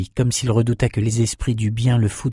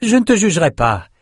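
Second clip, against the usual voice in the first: mild call, higher, stress on te; 9 semitones higher than usual. Acted. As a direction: reassure him from a distance. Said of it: "cria-t-elle à Bannon". (appel modéré vers Bannon, voix plus aiguë)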